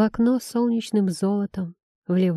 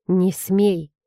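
usual speaking voice

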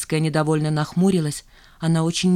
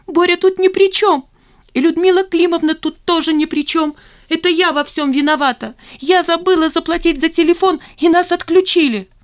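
very high, loud hysterical confession; shrieking; 10 semitones higher, 5 dB louder than usual. A narrator's reading. Zina confessing she forgot the phone bill, shrieking. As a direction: squeal it out, pitched right up. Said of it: "снова взвизгнула".

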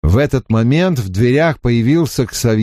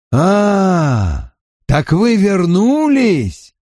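triumphant; 10 semitones higher, much slower than usual. A narrator's reading. Triumphant greeting.

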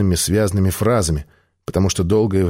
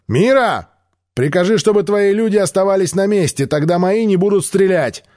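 shouted terms; 9 semitones higher than usual. Acted. Альп кричит Мире условия перемирия. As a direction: bark the terms across the field. Shouting orders to enemy leader.

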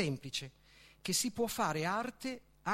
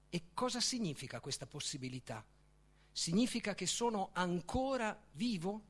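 ordinary delivery

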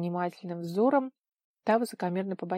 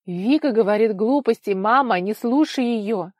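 agitated plea; 3 semitones higher, 10 dB louder than usual. A narrator's reading. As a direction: urge your mother loudly, stressing each phrase. Alarmed son urges mother. (взволнованно и громко убеждая)

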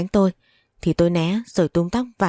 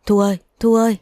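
raised, carrying call with the name held; calling out loudly; 3 semitones higher, 4 dB louder than usual. A narrator's reading. Husband calling for Thu inside the house, calling out loudly. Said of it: "Chồng tôi gọi to".

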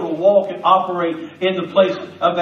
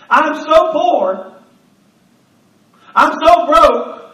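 drawn-out, higher pleading on so; slow pleading; 6 semitones higher, 4 dB louder, much slower than usual. Acted. Widow telling the prophet how broke she is, slow pleading. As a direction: stretch the so like a desperate plea. Woman pleading her poverty.

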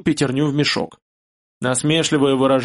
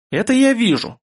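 angry; 9 semitones higher than usual. A narrator's reading. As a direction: snap back in anger. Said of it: "сказала Медея зло".

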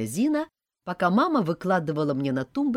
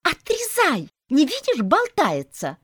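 high, loud, excited shriek; child squealing; 13 semitones higher, 7 dB louder, slower than usual. A narrator's reading. Child urging others to cut the toy, squealing. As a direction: squeal orders like an overexcited kid. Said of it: "визжала".

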